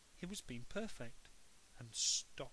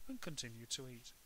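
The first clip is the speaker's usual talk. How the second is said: ordinary delivery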